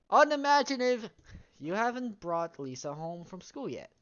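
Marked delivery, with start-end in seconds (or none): 0.1-1.1 mocking shout. Real, not acted. Mocking the game's writing.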